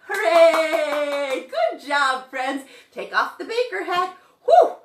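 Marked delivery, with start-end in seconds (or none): 0.1-1.5 loud, long hooray; loud cheer. Real, not acted. Teacher applauding children after the song, loud long cheer. 4.4-4.7 high, loud whew of relief; hot "whew". Real, not acted. Taking off the baker hat, loud relieved whew. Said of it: "getting a little hot in there".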